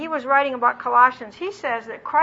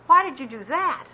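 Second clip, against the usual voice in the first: accusing tone; 2 semitones higher than usual. Retold or imitated. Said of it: "in an accusing tone of voice".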